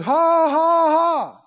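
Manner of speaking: loud put-on "ha, ha, ha"; mock forced laugh. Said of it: "He said".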